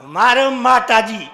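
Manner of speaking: loud cheering call; gleeful shout. Acted. Husband cheering wife beating his friend, loud shout. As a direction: egg her on at full voice.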